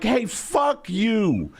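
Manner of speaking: slow, drawn-out "Hey, fuck you"; lazy pioneer telling someone off; slow hammed-up brush-off; mock settler tweet. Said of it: "it'd be like".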